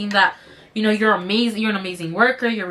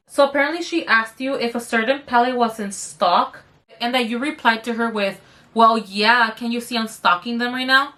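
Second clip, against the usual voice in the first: ordinary delivery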